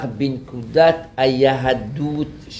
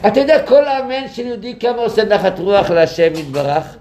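fervent rabbi; 7 semitones higher than usual. Retold or imitated. Rabbi lecturing with fervor.

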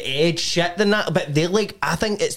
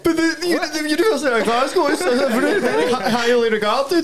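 mock pompous voice; 10 semitones higher than usual. Friend mocks posh self-importance.

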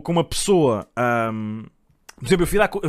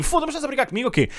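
higher, hammed-up outrage; mock indignant guy; 9 semitones higher, 4 dB quieter, faster than usual. The guy protesting to his girlfriend, mock outrage, higher. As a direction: act the boyfriend who can't believe it. Guy's indignant quote acted.

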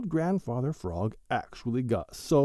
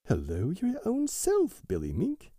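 shouted reply; 9 semitones higher than usual. A narrator's reading. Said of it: "shouted Jerry Muskrat".

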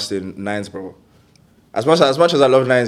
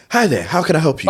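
chirpy, higher robot shop assistant; 6 semitones higher, 4 dB louder than usual. Retold or imitated. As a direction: put on a bright machine greeting. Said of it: "and you're seeing a robot".

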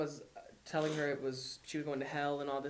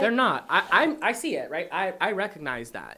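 emphatic; 4 semitones higher, 11 dB louder than usual. Real, not acted. Emphatic agreement in a discussion.

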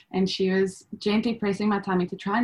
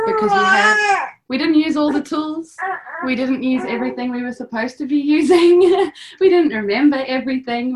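higher and louder, voice catching with tears; tearful; 7 semitones higher, 8 dB louder than usual. Real, not acted. Mother crying with gratitude for the birth course. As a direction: fight the tears as you speak.

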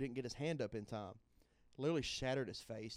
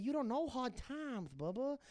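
high, slow lisping wrestling promo drawl; Dusty Rhodes voice; 11 semitones higher, slower than usual. Retold or imitated. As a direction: preach it slowly like a Southern wrestling star. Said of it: "You ever heard my Dusty Rhodes?".

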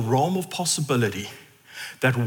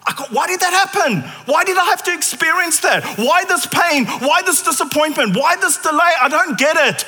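much higher, loud, fast cries of why; anguished protest; 11 semitones higher, 4 dB louder than usual. Retold or imitated. A suffering believer crying out to God, loud. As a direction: plead at the sky in pain. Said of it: "voicing a sufferer's complaint".

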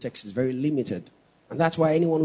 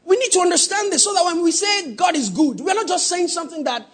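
louder, higher, driving preacher's cry; preaching at full force; 11 semitones higher, 4 dB louder than usual. Acted. Preacher presses the congregation on God's love, shouting. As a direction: build the point to the congregation.

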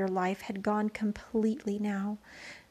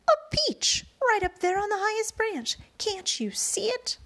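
excited shout; 9 semitones higher, 4 dB louder than usual. A narrator's reading. Excited shouting about the peach.

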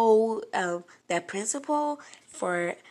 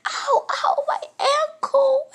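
much higher, loud, slow hammed-up wail; fake crying; 11 dB louder, much slower than usual. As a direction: overact the pain, drawn out. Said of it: "fake crying crocodile tears".

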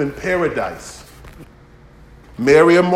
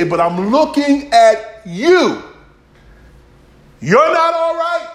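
rising preaching pitch; 11 semitones higher, 7 dB louder, slower than usual. Acted. Preacher quoting people who look at you.

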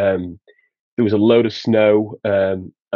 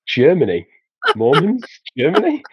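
mock disbelief, each word rising higher; baffled; 6 semitones higher than usual. Retelling the man at the door failing to compute, mock baffled. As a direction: imitate a man who cannot compute, word by word. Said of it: "this guy just didn't compute".

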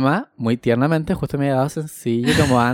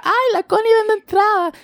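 put-on very high, bright girl in the queue; girly voice. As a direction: play the chirpy girl pointing someone out. Voicing a girl in the line.

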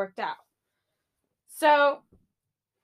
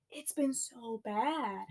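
much softer, slow; sad murmur; 10 dB quieter, slower than usual. Real, not acted. Vlogger admitting a bad week, soft and sad. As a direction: let it sink.

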